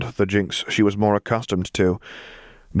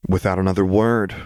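usual speaking voice

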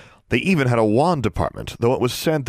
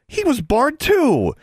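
high, slow tattling accusation; accusing; 3 dB louder, slower than usual. A narrator's reading. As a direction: point the finger, drawn out and high. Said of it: "pointing at the other boy accusingly".

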